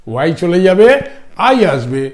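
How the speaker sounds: usual speaking voice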